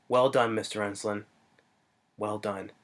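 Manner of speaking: low, soft acted praise; understated murmur, imitating a film character; quiet congratulation. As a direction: congratulate him in a hushed low voice. Retelling Samuel Jackson's congratulation.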